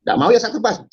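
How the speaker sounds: high-pitched, insistent threat; retold threat. Attacker warning those who refuse, high retold threat. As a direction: threaten them, voice pitched well up. Retelling his threat to behead.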